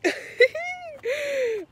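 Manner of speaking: very high, crying-like laugh; squealing laugh. Real, not acted. Laughing walking backwards.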